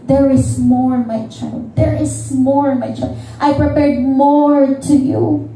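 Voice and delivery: the Lord pleading tenderly. Acted. God urging the congregation to let go, half-singing tenderly. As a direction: coax gently, like a loving father.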